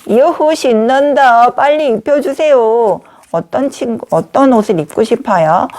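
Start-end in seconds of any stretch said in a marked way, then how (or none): 0.1-3.0 higher-pitched pretend cheer; excited doll voice. Paper doll begs to be dressed, excited pretend voice. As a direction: play an eager doll, drawing out the cheer.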